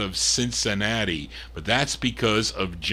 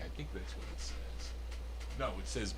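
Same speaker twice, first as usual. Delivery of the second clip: unsure whispered aside; 3 semitones lower, 17 dB quieter, faster than usual. Real, not acted. Reading label, unsure aside.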